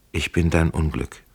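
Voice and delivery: plain reading voice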